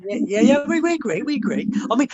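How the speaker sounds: high, loud, eager agreement; excited agreement. Real, not acted. Agreeing with a mate's football rivalry joke, excited and high. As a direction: jump in loudly with pitch shooting up.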